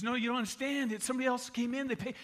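high, flustered cashier; acted bewildered protest; confused. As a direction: plead to explain, breaking off unfinished. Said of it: "the guy was confused".

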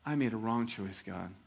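low, soft and slow admission of fault; contrite confession. Acted. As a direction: confess quietly to God. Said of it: "should have stopped there and said".

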